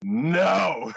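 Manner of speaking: loud flat refusal; mock shouted no. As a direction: bark out a firm no.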